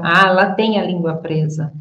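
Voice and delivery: ordinary talk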